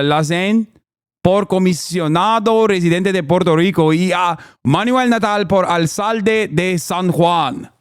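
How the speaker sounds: lilting attempt at a native Spanish accent; earnest Spanish accent. Shown as a demonstration. Streamer retries the endorsement for critics, earnest Spanish accent. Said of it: "when I do a good Spanish accent".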